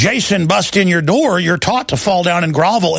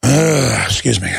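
low calm apology after rant; 7 semitones lower, much slower than usual. Real, not acted. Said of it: "I start getting really mad".

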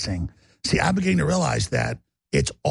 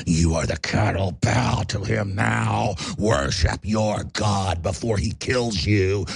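roaring tyrant king; 7 semitones lower than usual. Acted. Sermon casting Zuckerberg as god-king.

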